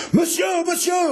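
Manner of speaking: high, loud desperate shout; roaring call. A narrator's reading. The queen roars up to Pardaillan, high and loud. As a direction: yell for him from below. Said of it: "rugit-elle".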